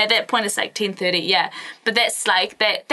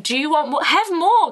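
pushy host; 8 semitones higher than usual. Retold or imitated. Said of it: "they're always like".

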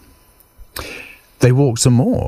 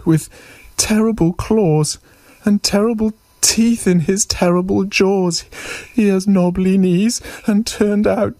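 high mouse voice, climbing as the monster appears; little mouse. Acted. Mouse meets the creature he made up, high startled mouse voice. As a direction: mouse going from smug to startled, ever higher.

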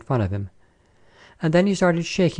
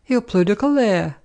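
delighted; 8 semitones higher than usual. A narrator's reading. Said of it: "said Madame delightedly".

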